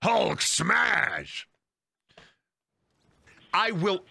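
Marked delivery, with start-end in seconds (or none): 0.0-1.5 slow, hammed-up hero shout; superhero battle cry. Recalling smashing toy gloves as a kid, mock hero shout. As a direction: bellow like a comic-book brute. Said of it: "smash them together and go".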